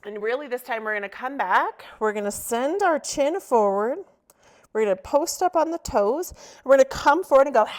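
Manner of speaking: loud, energetic coaching building to the release; rally the class loudly toward a big breath; rousing exercise cue. Real, not acted.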